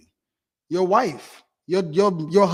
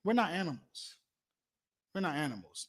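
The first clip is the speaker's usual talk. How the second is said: hushed insistence; 12 dB quieter than usual. Real, not acted. Preacher reminding the congregation we are not animals, hushed. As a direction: say it quietly so it lands. Quiet emphatic repeat.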